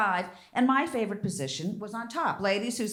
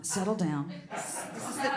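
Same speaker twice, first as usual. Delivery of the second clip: usual speaking voice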